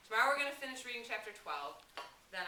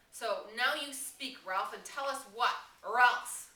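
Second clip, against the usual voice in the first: insistent demand; 6 dB louder, much slower than usual. A narrator's reading. Piggy demanding in assembly.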